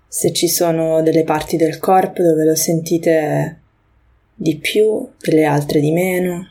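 unhurried meditation guiding, long pauses between phrases; guided meditation. Acted. Podcast host leading listeners through a grounding moment, guided meditation. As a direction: guide listeners gently into the breath, leaving space.

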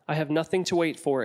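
ordinary delivery

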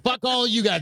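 high-pitched mock defiance; mock defiant yell. Imagining a partygoer telling everyone off, high mock defiance. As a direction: fling it at the crowd in a put-on voice.

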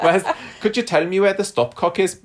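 high, laughing put-on Welsh accent. Playing a Welsh buyer asking about the stopcock, giggly Welsh accent. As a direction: sing it out like a Welsh householder, giggling. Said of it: "that's a Welsh accent".

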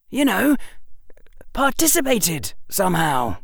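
put-on boyish, eager storytelling; young Harry voice. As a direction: voice a clever young boy. Harry continues.

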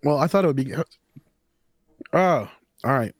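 choking on a drink mid-sentence; words forced out after swallowing wrong; coughing up water. Real, not acted. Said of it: "[name] went down the wrong pipe".

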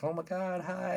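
high-pitched mock greeting; imitated old ladies. Two old ladies greeting a dad at the park, imitated high. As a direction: put on a high, light little-lady voice.

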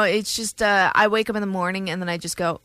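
ordinary delivery

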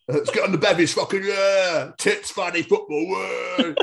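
laddy lad voice. Retold or imitated. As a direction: play a rowdy lad off to the pub. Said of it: "I'm feeling a bit like".

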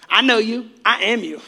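usual speaking voice

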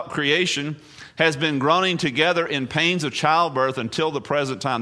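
ordinary talk

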